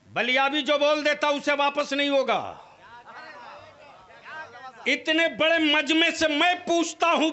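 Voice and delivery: loud, high, fervent sermon delivery; fiery preaching. Acted. Preacher challenging the gathering on dowry, loud fervent preaching. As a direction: preach it hard and rouse the crowd.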